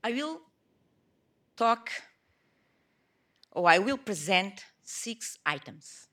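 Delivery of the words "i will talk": ordinary talk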